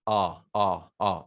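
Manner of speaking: "आ" sounded alone, low and separate; vowel sound demo. Shown as a demonstration. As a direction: repeat "आ" as an isolated open vowel. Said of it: "ये जो symbol है IPA में".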